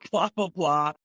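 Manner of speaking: tired, fed-up groan that drags; burnt-out whine. Retold or imitated. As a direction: sound worn out and done with it. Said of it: "I was so burnt out from it".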